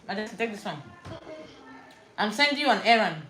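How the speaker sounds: ordinary talk, nothing marked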